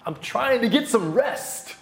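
put-on a tired dad hushing noisy kids; lightly voiced exhausted dad. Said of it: "And he just said".

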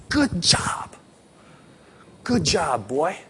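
put-on whispered praise to a frightened child; tender hushed good job; soft whisper. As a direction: breathe it gently into his ear. Father soothing son during shot.

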